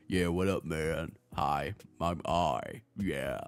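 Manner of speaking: low, slow dude voice; imitating a deep-voiced, laid-back bro; mock macho guy. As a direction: drop way down into a put-on manly drawl. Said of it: "what was he think I was gonna sound".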